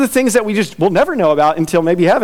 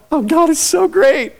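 mock delighted gush, higher; 10 semitones higher than usual. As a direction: gasp at how great it is. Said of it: "He wants you to go".

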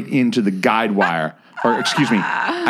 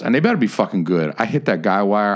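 ordinary delivery

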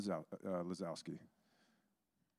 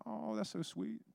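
high, soft imitation of a girl; touched girl's voice; 12 semitones higher, 5 dB quieter than usual. Girl told she is God's favorite, touched. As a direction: coo it high and softly.

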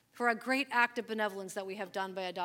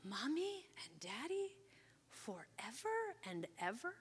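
whispered, slow, wondering question; awed whisper; 3 semitones higher, 11 dB quieter, slower than usual. Retold or imitated. Little girl realizing her family is forever, whispered in awe. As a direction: breathe it out in wonder. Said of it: "she was like".

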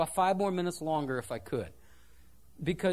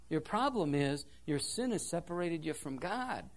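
usual speaking voice

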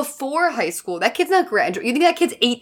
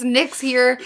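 normal talking voice

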